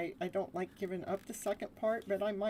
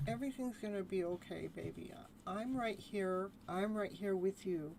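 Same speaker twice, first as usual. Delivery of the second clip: put-on soft, tender comfort to a small child; reassuring inner child; 7 dB quieter than usual. Shown as a demonstration. Speaking to the inner child, soft reassuring voice. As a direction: hush and soothe like a parent. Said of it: "take care of that child within".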